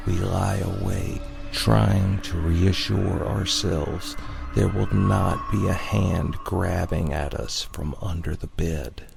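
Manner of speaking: ordinary delivery, nothing marked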